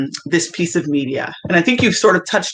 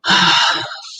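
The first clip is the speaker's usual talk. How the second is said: moved sigh; 3 semitones higher than usual. Real, not acted. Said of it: "Have to breathe after that".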